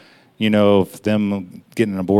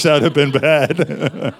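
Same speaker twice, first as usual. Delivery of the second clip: laughing relief; 4 semitones higher, slower than usual. Real, not acted. Relieved laugh after risky word.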